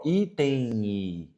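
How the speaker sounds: item said slowly, its M turned into an I sound; slow pronunciation demo. Shown as a demonstration. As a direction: stretch out item so the I glide is heard. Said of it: "ele se transforma num I".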